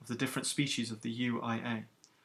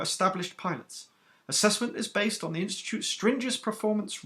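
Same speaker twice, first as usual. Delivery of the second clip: stern captain, higher and louder than the narration; iron-voiced captain; 6 semitones higher, 4 dB louder than usual. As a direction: rebuke the recruits who kept chattering. Captain briefs the trainees.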